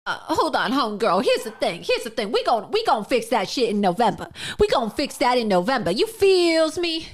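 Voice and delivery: mock her put-on accent for laughs; mocking parody of Kamala Harris.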